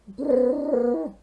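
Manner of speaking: loud shivering brrr; cold splutter brrr. Acted. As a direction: shiver loudly from icy water. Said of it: "he spluttered".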